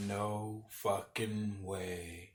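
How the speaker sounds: very soft, low and drawn out; stunned disbelief. Retold or imitated. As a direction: let each word sink in, almost under your breath. Retells his own stunned disbelief.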